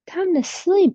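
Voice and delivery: usual speaking voice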